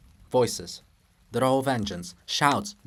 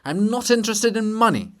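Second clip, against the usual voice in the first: high loud offended retort; deeply indignant; 8 semitones higher, 6 dB louder than usual. Acted. Shevchenko snaps at Hrushevsky, deeply indignant and loud. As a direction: bristle with outrage. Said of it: "more deeply indignant still".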